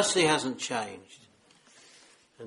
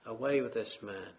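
softer, low, solemn repeat; quiet echo; 4 semitones lower, 6 dB quieter than usual. Retold or imitated. Preacher echoing the world's rejection, quiet and solemn. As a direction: let the words fall quietly, grave. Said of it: "has the same message on their lips".

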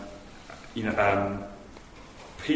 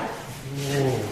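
gloomy Eeyore groan. Retold or imitated. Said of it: "a bit like Eeyore".

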